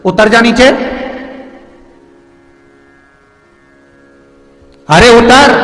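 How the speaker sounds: shouted order. Acted. Kaki ordering Kanhaiya down.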